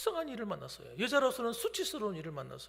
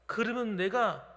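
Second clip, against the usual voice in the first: usual speaking voice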